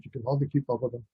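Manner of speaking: rattled off fast like a New Yorker; fast-talking New Yorker demo. Shown as a demonstration. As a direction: race through it New York style. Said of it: "Like that".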